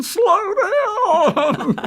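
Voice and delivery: high, drawn-out cartoon wail; hammed-up high-pitched plea; old woman wailing. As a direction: cry it out like a panicked old lady braking. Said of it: "she's old and holding onto the brakes".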